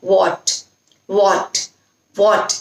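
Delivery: "What" with a rounded "aw" vowel and crisp t; "What" said as "wawt", carefully; correct "what". Shown as a demonstration. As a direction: model the word "What" slowly and clearly. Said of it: "इस तरह से उसको pronounce करना है".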